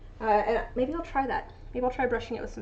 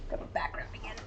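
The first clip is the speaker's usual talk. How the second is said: whisper; 10 dB quieter, faster than usual. Real, not acted. Muttering to herself.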